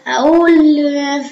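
usual speaking voice

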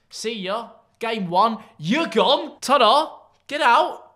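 high, crowing goodbyes, rising as they go; taunting send-off. Real, not acted. Taunting a beaten opponent's exit.

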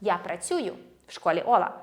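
ordinary talk

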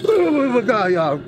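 loud, high shriek falling away; frightened scream. Real, not acted. Frightened by the game.

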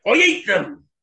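loud, high-pitched lion threat; threatening lion. A narrator's reading. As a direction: burst out with a fierce lion's threat. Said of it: "said the lion".

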